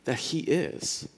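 slow, disdainful "Who does he think that he is?"; indignant scribes. Retold or imitated. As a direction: sneer it out slowly. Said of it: "the scribes are like".

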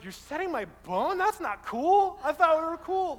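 loopy patient protesting loudly. Retold or imitated. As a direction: play the drugged-up patient, whining. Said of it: "he's like".

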